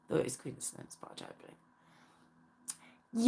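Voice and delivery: hushed, breathy whisper, almost secret. Real, not acted. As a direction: lean in and whisper it like a confession. Lowers voice to a secret.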